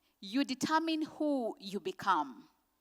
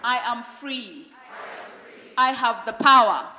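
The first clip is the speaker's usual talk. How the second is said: loud, firm declaration for the crowd to echo; with conviction; 6 dB louder than usual. Shown as a demonstration. Speaker leading an audience in affirmations, loud and firm. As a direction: call out each affirmation so they repeat it. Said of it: "Please say this with conviction".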